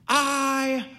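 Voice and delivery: very slow, high, stretched-out shout; yelling back. A narrator's reading. Woman on clothesline answers the doorman, long drawn-out yell. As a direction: holler each word long and loud. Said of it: "she yelled back".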